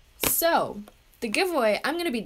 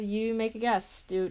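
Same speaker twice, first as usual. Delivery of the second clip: normal talking voice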